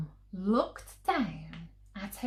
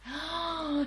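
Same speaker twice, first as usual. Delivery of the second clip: gasp; 10 semitones higher than usual. Acted. Mum about to outbid herself.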